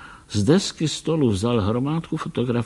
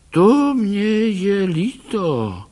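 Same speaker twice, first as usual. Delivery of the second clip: put-on high, slow, sorry old-man voice; sympathetic old doctor; 10 semitones higher, 4 dB louder, much slower than usual. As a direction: soften into pity for him. Doktor lituje postřeleného tygra. (soucitně, pomalu, vysoký hlas)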